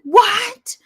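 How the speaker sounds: high loud shocked what; surprised. Real, not acted. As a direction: react in sudden disbelief. Surprise reaction.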